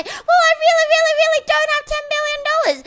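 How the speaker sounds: high, fast, hammed-up wailing; mock crying. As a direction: wail apologetically. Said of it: "cry more and say".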